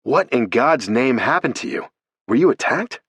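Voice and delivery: high, stunned outcry; shocked. A narrator's reading. Oliver shocked at Alex's battered state, high outcry. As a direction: react to her battered state.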